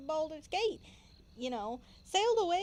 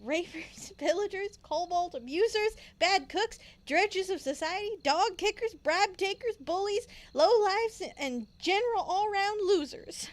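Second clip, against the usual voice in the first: building rant; 5 semitones higher, 4 dB louder than usual. Acted. Player reads the child's list of insults, building to a loud rant. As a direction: list rising louder and higher to the end.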